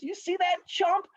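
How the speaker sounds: high, slowed scornful question; scoffing. Acted. Rabbit to cheerleaders about the turtle, scoffing.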